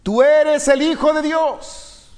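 high, slow cry of the demons; drawn-out high demon cry. A narrator's reading. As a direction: cry it out like possessed men. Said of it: "dando voces y diciendo".

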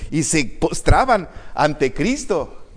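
normal talking voice

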